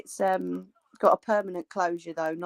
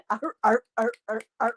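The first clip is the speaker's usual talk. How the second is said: fake laugh; 2 semitones higher than usual. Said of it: "fake laughs".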